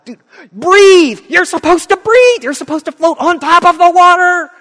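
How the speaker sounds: high, loud desperate yell; shouting at drowning man. Acted. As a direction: yell it up at someone going under.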